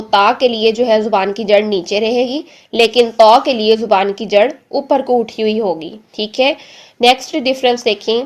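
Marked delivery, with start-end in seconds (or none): none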